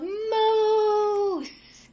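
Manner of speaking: loud excited yell of moose; a boy yelling at a moose. A narrator's reading. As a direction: shout it out across the yard.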